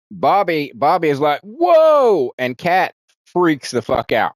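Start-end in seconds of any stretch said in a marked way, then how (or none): 1.4-2.3 a loud, high cry of wonder; amazed whoa. Retold or imitated. Bobby seeing the wall open, a loud high whoa. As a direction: shout it in pure amazement.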